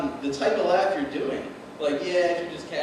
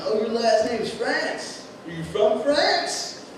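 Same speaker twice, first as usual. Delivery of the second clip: put-on higher, slow, teasing kid voice; lightly mocking schoolkids; 7 semitones higher, much slower than usual. Schoolkids teasing him about his name, slow high taunt. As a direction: drawl the taunt like a school bully. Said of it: "they used to ask me".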